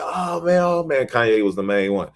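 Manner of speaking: high, hyped declaration; excited crowd. Retold or imitated. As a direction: crown him with the name. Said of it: "They was like".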